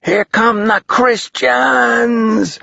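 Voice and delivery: high, slow drawn-out yell; yelled warning. A narrator's reading. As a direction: cry the alarm down the roads. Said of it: "yelled voices below".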